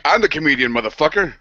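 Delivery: a mild mock of a know-it-all joker; mock cocky comedian. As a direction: play the smug comic. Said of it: "God's like".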